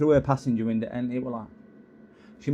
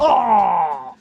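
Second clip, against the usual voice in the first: loud sudden yelp; startled cry; 6 semitones higher, 8 dB louder than usual. Real, not acted. Owner yelping as the dog catches him. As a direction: yelp in pain.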